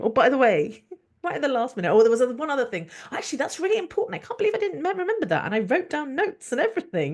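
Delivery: high, urgent last-minute plea; mock-flustered patient piping up at the last minute; flustered afterthought. Acts out her last-minute afterthought to doctor.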